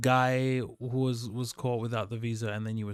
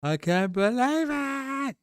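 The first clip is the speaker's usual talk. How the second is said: disbelief; 11 semitones higher, slower than usual. Retold or imitated. Arrested guy in disbelief.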